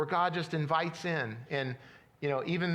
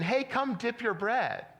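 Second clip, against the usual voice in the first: inviting call; 6 semitones higher than usual. Acted. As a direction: beckon people over to the table. Models inviting people in to eat.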